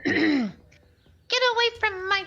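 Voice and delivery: put-on high, loud, slow old aunt voice; protective aunt. Aunt defending her niece from the elf, high shrill yell. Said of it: "now that you're playing her aunt as well".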